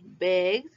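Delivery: "Begged" as one syllable, "begd"; voiced final D. Shown as a demonstration. Teacher says repeat after me, voiced final D. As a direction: end "Begged" on a vibrating d, no e. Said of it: "es con vibración".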